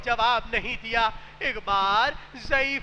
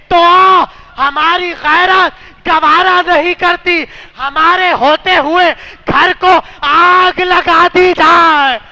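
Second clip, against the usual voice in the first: loud, higher anguished cry; tearful cry; 4 semitones higher, 17 dB louder than usual. A narrator's reading. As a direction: cry out with wounded honour. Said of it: "رو کر کہنے لگے".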